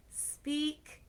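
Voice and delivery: plain reading voice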